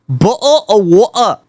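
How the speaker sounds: English accent, Ts dropped in "bottle" and "water"; glottal-stop English take on "bottle of water". Shown as a demonstration. User runs through accents again, English accent. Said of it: "do the English accent".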